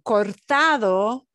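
"Cortado" drawn out slowly with a rising end; slow Spanish word. Shown as a demonstration. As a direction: stretch "Cortado" carefully so each syllable lands. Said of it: "I wanna point out is that cortado word".

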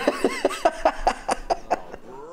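tawa yang sangat tinggi dan panjang; tawa melengking. Real, not acted. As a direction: biarkan tawa melengking pecah. Laughs after blaming chat.

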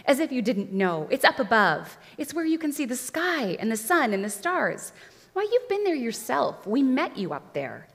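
insistent, rising protest; emphatic boyish insistence; fighting enchantment. A narrator's reading. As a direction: argue back hard, pitch leaping up. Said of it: "fighting hard against the enchantment".

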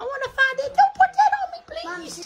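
high pleading dog voice; dog's voice. Pretend. Speaking for the frightened dog, high pleading voice.

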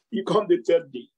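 ordinary talk

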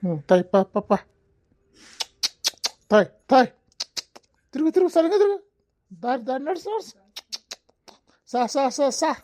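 urging bullocks. Acted. Cart driver yelling at bullocks to turn properly, loud. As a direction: drive them round with a shout.

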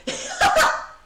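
loud, high triumphant laugh; high burst of laughter. Real, not acted. Streamer delighted by the swindle, high laughing burst.

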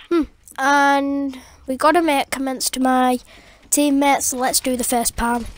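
ordinary delivery

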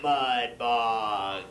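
very low, put-on radio voice; radio guy. Mimicking an annoying man on radio, deep announcer voice. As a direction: drop way down for a radio promo. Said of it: "this guy on the radio".

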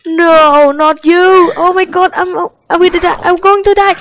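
high, loud cry of alarm; panicked yell. Real, not acted. Player sure they are going to die, panicked high yell. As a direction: cry out as if doomed, pitch climbing. Panics as a feared enemy appears.

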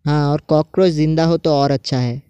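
ordinary talk

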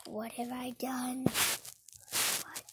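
hushed, breath-only horror; whispered remorse. Pretend. Villain regretting pushing Father off, whispering. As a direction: whisper it in play-acted regret. Pretend remorse after the push.

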